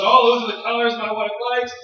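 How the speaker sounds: husband admiring a card for his wife, delighted. Retold or imitated. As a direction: gush over the card like a proud husband.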